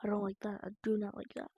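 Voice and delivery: scared whisper. Real, not acted. Scared of the monster in game.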